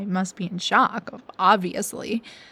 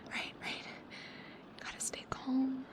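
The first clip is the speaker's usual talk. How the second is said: hushed, breathy whisper, talking herself down; 4 semitones higher, 15 dB quieter than usual. Acted. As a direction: whisper it like a frightened mantra. Repeats the listener's advice to herself.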